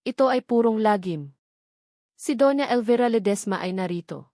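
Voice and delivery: usual speaking voice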